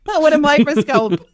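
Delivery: high, loud laughing joke; high and loud, laughing through each word. Real, not acted. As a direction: laugh your way through the jab. Joking about rare decent politicians.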